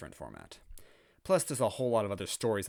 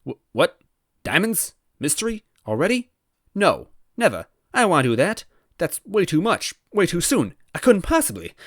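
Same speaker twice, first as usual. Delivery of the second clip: high, loud flustered babble; panicked refusal; 9 semitones higher, 16 dB louder than usual. Acted. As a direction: scramble to get out of it in a panic. Luigi panics at mystery.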